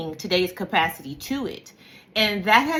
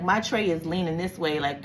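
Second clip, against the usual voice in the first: ordinary delivery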